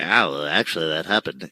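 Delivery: nasal know-it-all correction; internet nerd voice. Retold or imitated. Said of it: "doing my internet nerd voice".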